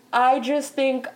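ordinary talk

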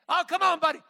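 put-on high, loud frantic pleading to a limp baby; a father shouting in panic to wake his son; panicked father. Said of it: "he thought he was losing him".

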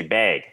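"bag" with a long Midwestern vowel, like "beg". Shown as a demonstration. Midwesterner showing the word that caught people, long-vowel "bag". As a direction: stretch the A in "bag" toward "ay". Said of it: "the one word that I do know always caught people".

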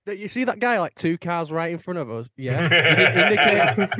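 high, loud ranting at the driver; indignant high yell, half laughing; imitated screaming girlfriend. Boyfriend imitates girlfriend raging about a driver, loud and high. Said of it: "she's screaming".

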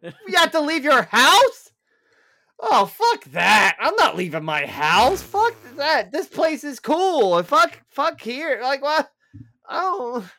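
loud, high, hammed-up disbelief; mock outrage. Homebody told he must leave his house, mock outrage. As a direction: yell it like an appalled shut-in.

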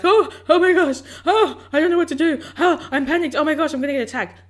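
high, loud gasping panic; hammed-up panic, pitched high; panicked. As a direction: act out a frantic, gasping freak-out. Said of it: "you're there panicked".

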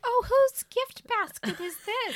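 mock delighted. Admiring the toiletry basket, high mock delight. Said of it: "I'm like".

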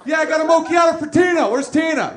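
loud, high cocky barista shout; brash counter guy announcing a drink loud; cocky barista call. Retold or imitated. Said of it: "He's like".

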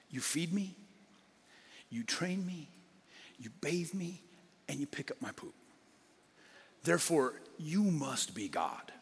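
soft, devoted voice that fades to a whisper; a mild, grateful dog in awe of its owner; adoring dog. Retold or imitated. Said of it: "a dog looks at its owner and says".

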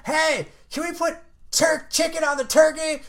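high-pitched mock call to the back; drawn-out, high holler, acted out; imitated yell. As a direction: call out slowly to the kitchen, imitating the clerk. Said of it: "She yelled in the back".

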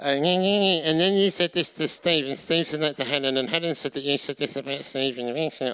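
lightly voiced grumbling complainer; complaining voice. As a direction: drag out the petty hearsay. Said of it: "the complaining voice".

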